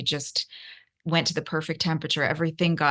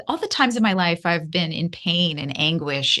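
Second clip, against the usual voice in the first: ordinary delivery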